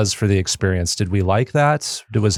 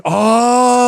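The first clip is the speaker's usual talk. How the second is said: excited gasp; 13 semitones higher, 6 dB louder than usual. Retold or imitated. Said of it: "and I'm like".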